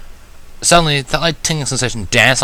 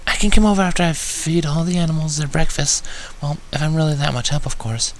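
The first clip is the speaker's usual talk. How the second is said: timid Fluttershy; 7 semitones higher than usual. Acted. Fluttershy shyly offers to help after feeding animals, fading timidly. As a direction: offer help, then doubt yourself.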